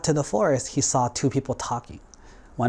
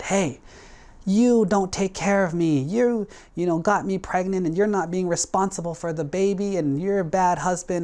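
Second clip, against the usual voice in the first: accusing outburst; 7 semitones higher than usual. Acted. Woman accuses the Buddha publicly.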